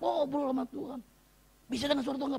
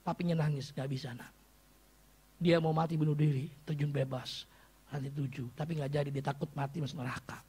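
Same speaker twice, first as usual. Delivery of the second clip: hushed sad retelling; 6 semitones lower than usual. Retold or imitated. Sudden drop to sad retelling.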